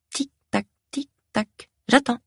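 high, soft clock imitation; teasing countdown. A narrator's reading. Caregiver making the doctor wait for help, teasing countdown. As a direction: tick it off lightly, high and quiet.

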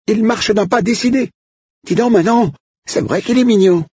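very high, excited squeal of a voice; piercing high voice, breathless with excitement; shrill excited cook. Acted. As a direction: pitch the cook way up in a giddy squeal. Odile gushing.

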